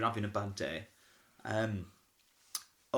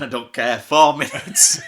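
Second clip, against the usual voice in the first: insistent louder; 12 dB louder than usual. Retold or imitated. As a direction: lay it down like a director who won't budge. Director insists firmly.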